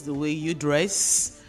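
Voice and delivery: ordinary talk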